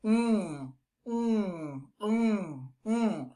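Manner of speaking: soft mm hums through the nose; nasal hum. Shown as a demonstration. Teacher showing how the nasal sound sounds. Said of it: "It sounds like this".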